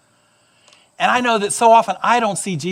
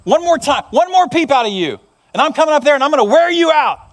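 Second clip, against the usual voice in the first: high, fast exasperated threat; father at the end of his patience; dad who's had it; 5 semitones higher, 3 dB louder than usual. Acted. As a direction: scold hard, building in pitch and pace. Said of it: "the dad had had it".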